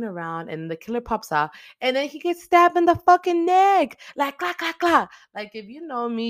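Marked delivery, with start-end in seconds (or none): 1.8-4.0 much higher and louder, bursting with glee; excited outburst. Real, not acted. Fan recapping the stabbing to viewers, excited outburst. 4.2-5.1 high clacking stab sounds; mock stabbing noises. Viewer mimicking the knife hits, high clacking noises.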